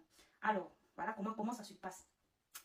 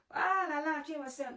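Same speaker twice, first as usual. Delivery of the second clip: aigu et fort, ravissement surjoué; s'extasie bruyamment sur la « sœur »; joie exagérée; 7 semitones higher, 12 dB louder than usual. Retold or imitated. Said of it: "il dit aux autres collègues". (s'exclame très fort, ravi et théâtral)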